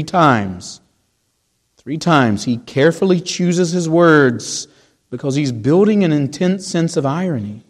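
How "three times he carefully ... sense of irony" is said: ordinary delivery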